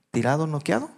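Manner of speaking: ordinary delivery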